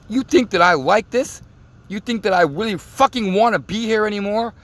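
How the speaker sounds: yelling at the man in the car in a retold fury; retold angry outburst. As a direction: shout it like you're done with everything. Retold rant at threatening man.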